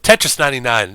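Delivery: ordinary talk, nothing marked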